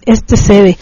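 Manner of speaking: usual speaking voice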